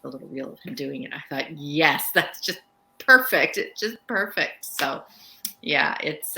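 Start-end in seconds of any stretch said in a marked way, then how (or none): 1.6-4.6 emphatic, raised-pitch approval, louder; delighted reaction. Retold or imitated. Author retelling her reaction to the audition reel, delighted. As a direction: relive the thrill of hearing it nailed.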